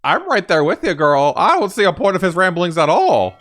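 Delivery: high, loud, eager agreement; excited agreement. Real, not acted. Agreeing loudly with game character.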